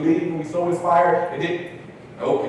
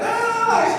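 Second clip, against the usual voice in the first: high-pitched mock cheer from the audience; imitated crowd cheer. Audience cheering the graduate, high mock cheer.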